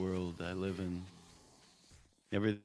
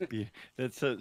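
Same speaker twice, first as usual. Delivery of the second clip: ordinary talk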